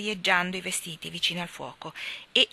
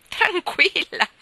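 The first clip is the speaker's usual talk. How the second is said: much higher, louder, slow word through laughter; John mocking the starling, laughing hard; laughing exclamation; 12 semitones higher, 11 dB louder, much slower than usual. A narrator's reading. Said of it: "esclamò John ridendo di cuore".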